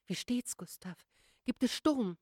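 hasty anxious question. A narrator's reading. Wife anxiously asks her husband about the storm, hasty. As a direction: fire off the question in alarm. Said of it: "fragte Frau Lagerlöf hastig".

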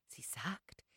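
unvoiced hushed reply; whisper. A narrator's reading. Mother whispers back to her sister-in-law, hushed. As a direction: answer under your breath.

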